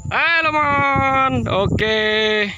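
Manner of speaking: very high, loud, drawn-out slow call; long calling hello. Real, not acted. Owner calling his pigeon back from the sky, long high call. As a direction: call the bird home across the field.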